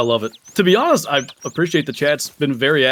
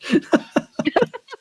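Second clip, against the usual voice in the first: squealing laugh at a chat message; high burst of laughter. Real, not acted.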